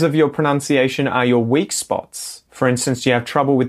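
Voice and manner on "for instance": normal talking voice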